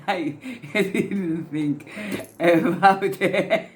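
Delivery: words squeezed out between giggles; laughing through words. Real, not acted.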